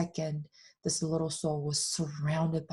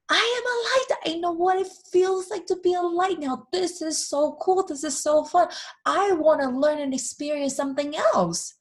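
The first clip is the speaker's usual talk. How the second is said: high and louder with excitement; thrilled little soul; 12 semitones higher, 5 dB louder than usual. Acted. Little soul tells God how fun being light is, high excited. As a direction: bubble over with joy.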